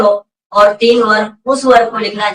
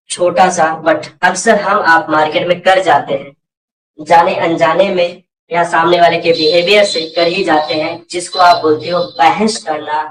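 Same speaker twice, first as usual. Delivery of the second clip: usual speaking voice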